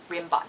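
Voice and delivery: Rimbaud said "rim-bod"; mistaken pronunciation. Shown as a demonstration. Repeating the common mistake, Rimbaud as "rim-bod". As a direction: end Rimbaud on a hard "bod". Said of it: "That's what everyone thinks".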